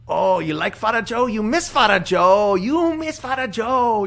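high, loud imitation of a Filipino priest; a Filipino accent, teasing and slow. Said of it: "Dude, your accents".

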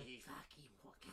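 lightly imitating a mate's Australian-Belfast accent; a hint of an Australian-Belfast twang. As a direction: do your mate's Aussie-Belfast mix. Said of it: "his accent is, like, mixed with Belfast".

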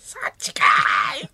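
high, slow stretched yell; mock tsukkomi shout. Both hosts echoing Oda's line, high mock shout. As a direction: squeal the retort up high. Repeating Oda's retort again.

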